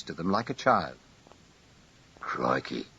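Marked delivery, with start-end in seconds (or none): 2.2-2.9 low, soft muttered word; under his breath. A narrator's reading. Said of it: "said Luke under his breath".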